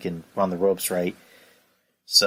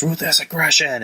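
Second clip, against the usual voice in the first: pitched-up shouted catchphrase; mock battle cry; 8 semitones higher than usual. Cena answering Kurt with a pitched-up shout. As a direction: bark it out like a rallying cry.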